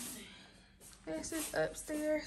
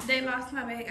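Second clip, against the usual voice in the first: ordinary delivery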